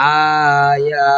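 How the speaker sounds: slow, stretched Quranic reading of আয়া; drawn-out recitation. Shown as a demonstration. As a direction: hold the vowels long in আয়া like tajweed recitation. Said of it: "demo of joined reading".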